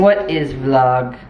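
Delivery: slow, puzzled robot voice; alien robot voice. Pretend. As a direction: play a curious alien robot. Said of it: "She is an alien robot".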